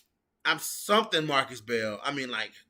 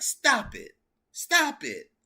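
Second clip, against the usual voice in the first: higher mock scolding; imitating Roxy; 9 semitones higher, slower than usual. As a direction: voice Roxy telling her to quit. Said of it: "she was like".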